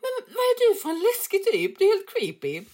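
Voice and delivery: high, loud mock-horrified cry; high, loud, spooked outburst; shocked disbelief. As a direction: act creeped out and shocked. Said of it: "Och vi bara".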